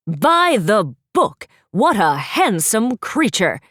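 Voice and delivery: slow, drawn-out delighted prince; a showy prince exclaiming in wonder. Acted. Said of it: "Daring exclaimed".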